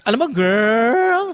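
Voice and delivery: high, slow imitation with throat croak; croaky drawn-out "girl". Imitating colleague who annoyed the host, croaky and drawn out. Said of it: "a little bit of a croak in your throat".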